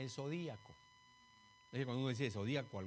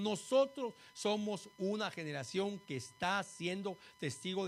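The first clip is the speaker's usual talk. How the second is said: louder, higher pulpit delivery; emphatic preaching; 5 semitones higher, 6 dB louder than usual. Real, not acted. Preacher telling the congregation they witness prophetic times, emphatic preaching. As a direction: press each phrase home with force.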